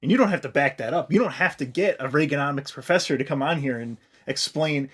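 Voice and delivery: ordinary delivery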